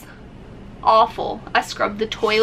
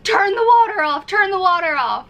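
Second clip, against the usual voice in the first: urgent yell; 8 semitones higher than usual. Retold or imitated. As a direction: shout the order in a panic. Yelling to workers during overflow.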